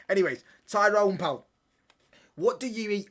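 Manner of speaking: loud, high call to a viewer. Real, not acted. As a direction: shout a name across the room. Calling out to a chat member.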